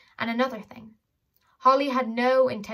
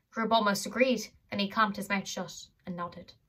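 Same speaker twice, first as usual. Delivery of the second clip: ordinary delivery, nothing marked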